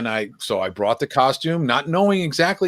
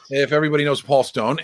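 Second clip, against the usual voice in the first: ordinary talk, nothing marked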